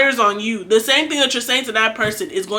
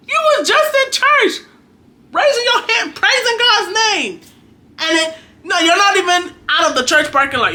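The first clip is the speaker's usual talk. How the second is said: high-pitched, louder incredulous scolding; high-pitched comic outrage; mock disbelief; 9 semitones higher, 3 dB louder than usual. Acted disbelief at hypocritical churchgoer.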